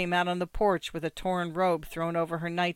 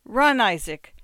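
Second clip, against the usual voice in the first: raised, loud shout to flee; urgent cry; 4 semitones higher, 8 dB louder than usual. A narrator's reading. Rabbi sending Isaac away, urgent cry. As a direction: shout to hurry the boy off. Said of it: "cried the rabbi".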